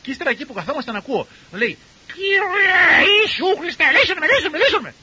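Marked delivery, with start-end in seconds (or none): 2.1-4.9 parrot reciting prayer. Retold or imitated. Parrot chanting the elder's prayer in his cell, loud high mimicry. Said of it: "Λέει ο παπαγάλος".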